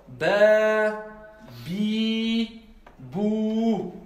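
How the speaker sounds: "Ba, bi, bou" each vowel held long and level; teacher model, drawing out every vowel; slow model reading for the class; long vowels demo. Shown as a demonstration. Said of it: "Donc, ça c'est bon".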